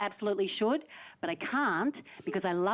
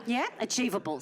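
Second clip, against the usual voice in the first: usual speaking voice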